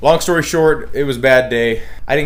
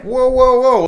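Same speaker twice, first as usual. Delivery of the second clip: high, loud retold protest; mock alarmed protest; 7 dB louder, slower than usual. Shooters protesting the sheriff's threat, high mock alarm. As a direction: throw up a startled whoa. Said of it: "We're like".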